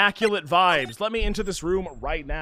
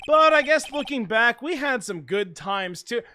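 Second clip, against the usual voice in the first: usual speaking voice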